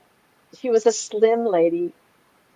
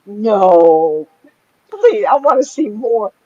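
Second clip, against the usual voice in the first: eager plea; 9 dB louder, much slower than usual. Retold or imitated. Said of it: "And I went".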